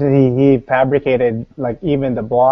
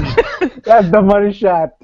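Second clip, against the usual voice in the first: slow, high sultry tease; drawn-out mock seductive line; mock porn voice; 8 semitones higher, slower than usual.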